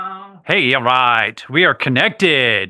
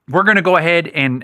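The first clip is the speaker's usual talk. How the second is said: ordinary delivery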